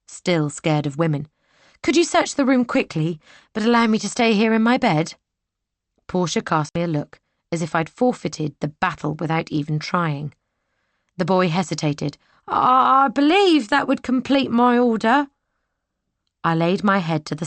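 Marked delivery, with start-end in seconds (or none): 1.8-5.1 put-on young lady's voice. Heroine pleads. 12.5-15.3 put-on nervous young soldier.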